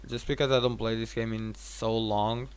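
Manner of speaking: ordinary delivery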